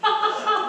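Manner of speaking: sudden high burst of laughter; a real, high, amused laugh. Real, not acted. Husband laughs at wife's jab.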